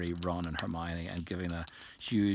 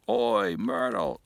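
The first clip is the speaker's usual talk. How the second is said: slow, drawn-out bellow, much louder; 4 semitones higher, 8 dB louder, slower than usual. A narrator's reading. Peeves calls the ghost girl over to make mischief, bellowing. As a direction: fill your lungs and call right across the room. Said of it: "took a deep breath and bellowed".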